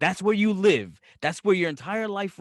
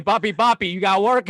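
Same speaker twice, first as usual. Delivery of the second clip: calling out loudly like a day laborer; hammed-up shout of a worker asking for a job; mock day laborer call; 6 dB louder than usual. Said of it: "on a Saturday saying".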